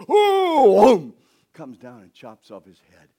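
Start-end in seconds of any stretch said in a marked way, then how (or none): 0.1-1.1 loud high straining grunt; heaving grunt. Acted. David swinging the huge sword, loud straining grunt. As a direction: heave the giant sword overhead. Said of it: "he lifts it up and goes".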